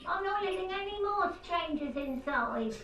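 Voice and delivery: put-on high old woman calling through a door; a cranky old lady yelling; elderly woman calling out. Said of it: "an elderly woman's voice calling out".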